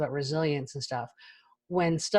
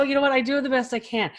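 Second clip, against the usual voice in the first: ordinary talk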